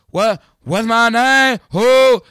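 high, loud rapper chant of the number. Retold or imitated. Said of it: "In my [name] voice".

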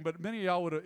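normal talking voice

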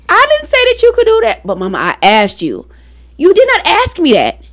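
a hint of arguing mother and kid; retold argument. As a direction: act both sides of the quarrel.

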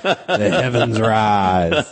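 slow slurred Southern drag queen drawl; drunken Southern drag queen. Shown as a demonstration. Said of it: "you have to say it like a really drunken Southern drag queen".

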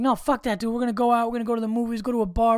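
rally a moping friend, pitch up; cheering someone up. Acted. Friend coaxing someone out after a breakup, upbeat urging. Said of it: "you're just trying to be like".

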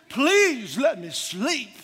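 hammed-up begging to be left alone; mock pleading. Retold plea to be left sleeping.

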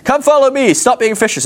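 calling out. Acted. Imagined Jesus calling the fishermen.

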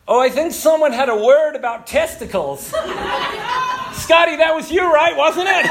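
American speaker outing him to the room; mock loud speaker. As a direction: tease him loudly before the crowd. Speaker calling out Scotty publicly.

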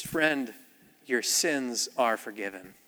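quiet tender blessing; slow soft words of forgiveness. Retold or imitated. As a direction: gently pronounce forgiveness. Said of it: "And he says".

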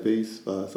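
"Vase" with a long AY, then "Voss" with AH; both pronunciations. Shown as a demonstration. Showing a partner both ways, alternating "Vase, Voss". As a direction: say "Vase" then "Voss", two different vowels. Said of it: "I say both".

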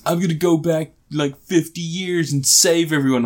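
lightly voiced surfer son announcing a plan; surfer dude voice. Son telling dad his rescue plan, light surfer voice. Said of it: "surfer dude voice".

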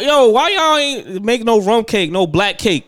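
high, loud teasing complaint; hammed-up outrage; mock indignant. Real, not acted. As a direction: rib her like it's an outrage. Teasing friend about Jamaican food.

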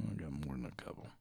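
hushed aside to the audience; whisper. Real, not acted. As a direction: breathe it out, no voice.

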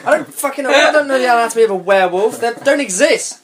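high, laughing exasperation; exasperated laugh. Real, not acted. Admitting ignorance to friends, laughing exasperation. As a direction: throw up your hands, laughing.

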